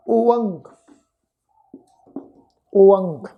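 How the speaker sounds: ਓਅੰਗ alone, o-ang with falling tune; syllable demo. Shown as a demonstration. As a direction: sound out ਓਅੰਗ as a separate piece. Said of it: "ਤੋ ਇਹਦਾ ਉਚਾਰਨ ਬਣੇਗਾ". (ਓਅੰਗ ਦਾ ਵੱਖਰਾ ਉਚਾਰਨ)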